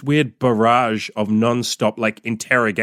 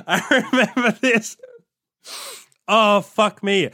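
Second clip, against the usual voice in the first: high-pitched laugh through the words; 10 semitones higher, slower than usual. Real, not acted. Remembering, laughing hard.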